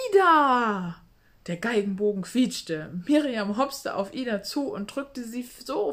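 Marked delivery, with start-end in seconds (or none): 0.1-1.0 a loud, high shout of the name; shouting with joy. A narrator's reading. Violinist shouts to her friend, loud and joyful. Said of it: "schrie die Geigerin".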